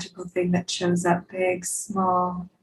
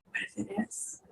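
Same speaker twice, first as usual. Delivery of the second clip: breathy whispered question; 3 semitones higher, 16 dB quieter than usual. Real, not acted. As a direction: whisper it softly.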